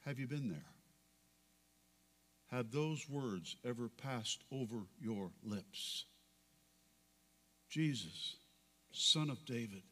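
whisper-close, begging for mercy; hushed prayer. Acted. Preacher voicing the beggar's prayer, low and soft.

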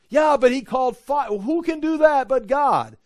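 loud, high acted comeback; insistent awed protest. Preacher playing someone who insists only God calls down fire, loud protest. Objector insists against the reply.

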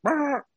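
high-pitched imitated chicken squawk. Televangelist acts out her chicken hit by a truck, high mock squawk. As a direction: squeal out a high, held bird noise. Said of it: "she did the whole action. So my chicken went".

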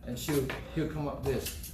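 ordinary delivery

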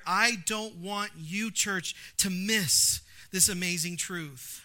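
slow, loud, pleading preacher; Paul pleading with the church in Ephesus, preached slowly; voicing Paul's plea. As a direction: press every word on the church like Paul.

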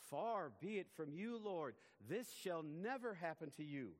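slow, loud, high-pitched protest stretching every word; emphatic rebuke. Acted. Peter rebuking Jesus, slow loud and high. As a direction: lean hard into Far and never.

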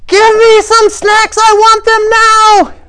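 very high, loud, stretched-out screech; full tantrum scream in a child's voice; completely losing it. Acted. Child demanding snacks at the checkout, screaming tantrum. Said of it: "completely lost it".

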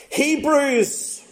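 eager reply. A narrator's reading. Old man answering about his reading, mildly eager. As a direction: read his reply with a lift in pitch.

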